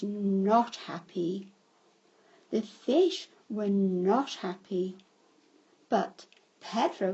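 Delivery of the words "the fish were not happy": ordinary delivery, nothing marked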